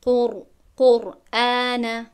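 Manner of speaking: قرآن with a full heavy ر, slow and drawn out; قر said emphatically with a thick, heavy ر; قر، قرآن slowly, ر pronounced with tafkhim; heavy Quranic r. Shown as a demonstration. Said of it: "نمیگویم قر".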